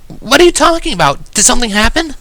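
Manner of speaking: high-pitched, loud worried questions; worried Twilight. A narrator's reading. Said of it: "sounding instantly worried".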